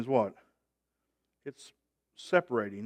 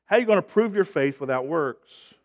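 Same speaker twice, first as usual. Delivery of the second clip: usual speaking voice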